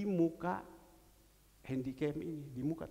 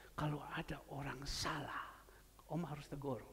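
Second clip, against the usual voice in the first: whisper; 6 semitones lower, 9 dB quieter than usual. Retold or imitated. Quiet advice to Alek.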